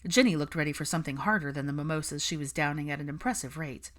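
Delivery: usual speaking voice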